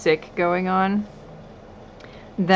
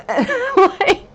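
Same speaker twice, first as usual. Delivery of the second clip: lost voice; 11 semitones higher than usual. Real, not acted. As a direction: voice gone, cracking into a squeak. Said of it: "I legitimately lost my voice".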